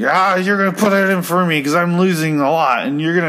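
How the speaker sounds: put-on high slurry drunk; drunk guy voice. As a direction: ham up a sloppy drunk asking a favour. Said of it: "literally sounds like this".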